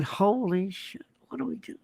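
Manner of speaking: imitating stunned opponents in a near whisper; hushed dismay. Radio host voicing what the Democrats are thinking, hushed dismay.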